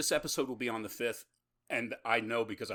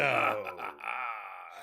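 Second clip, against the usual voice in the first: hearty hammed-up pirate laugh; 4 semitones lower than usual. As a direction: throw in a big pirate laugh. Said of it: "Why did I throw a pirate laugh".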